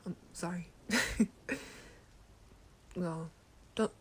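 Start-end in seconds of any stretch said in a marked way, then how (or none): 0.9-1.9 short higher-pitched chuckle. Acted. Apologising to the listener, leftover chuckling. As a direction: let a last giggle out.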